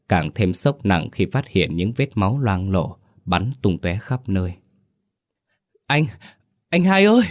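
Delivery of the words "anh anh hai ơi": high loud wail; girl wailing for her missing brother; crying out. Acted. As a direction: call her brother while bursting into tears. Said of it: "Đào òa khóc nức nở".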